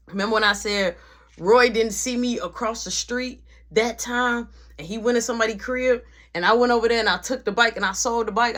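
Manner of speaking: usual speaking voice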